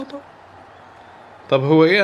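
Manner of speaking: ordinary talk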